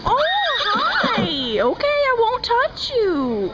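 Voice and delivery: usual speaking voice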